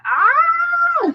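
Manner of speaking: a high, strained frustrated cry; high yelp of remembered rage; frustrated shriek. Retold or imitated. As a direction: let out a squeal of frustration. Retelling boiling frustration.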